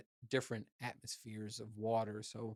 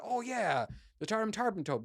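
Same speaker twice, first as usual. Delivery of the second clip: much higher, bluffing mock tone; mock knowing; 10 semitones higher than usual. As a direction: pretend you know the fish, mockingly high. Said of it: "and I'm like".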